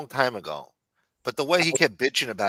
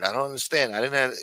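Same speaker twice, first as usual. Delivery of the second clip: usual speaking voice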